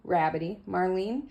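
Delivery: plain reading voice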